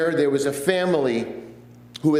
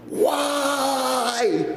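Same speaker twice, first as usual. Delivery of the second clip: anguished yell; 10 semitones higher than usual. Retold or imitated. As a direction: hurl the question at God. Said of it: "he yells at the top of his lungs".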